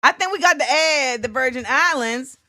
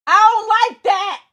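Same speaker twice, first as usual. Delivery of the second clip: "I don't like that" shouted higher and louder, stress on "I"; louder repeat; 10 semitones higher, 5 dB louder than usual. Real, not acted. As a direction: blurt it out as a louder complaint. Repeats complaint, second time shouted.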